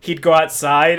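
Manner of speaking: ordinary talk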